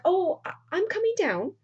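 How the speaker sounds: high-pitched, halting retold reply; mock-flustered high voice; flustered call. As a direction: call down as if caught out. Roxanne calling down, flustered.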